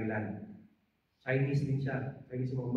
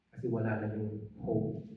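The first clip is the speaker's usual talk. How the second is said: tender confession; 4 semitones lower, 9 dB quieter than usual. Real, not acted. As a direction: hush it as a confession.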